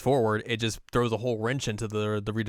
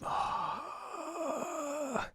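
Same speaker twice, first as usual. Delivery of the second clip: long high sigh; 8 dB quieter than usual. Retold or imitated. Majima sighs.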